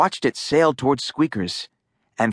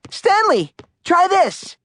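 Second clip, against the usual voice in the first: calling out; 3 dB louder than usual. A narrator's reading. Arthur calls up.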